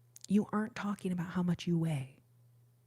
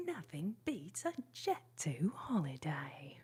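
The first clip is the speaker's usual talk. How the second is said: softly voiced, badly faked British ad accent; 3 semitones lower, 6 dB quieter than usual. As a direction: mimic the British holiday ad in a bad British accent. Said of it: "I do a horrible, horrible accent".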